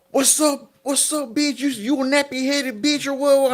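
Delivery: high-pitched, drawn-out gang challenge; confrontational taunt. Retold or imitated.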